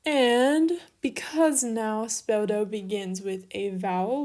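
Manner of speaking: ordinary delivery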